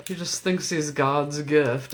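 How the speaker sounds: usual speaking voice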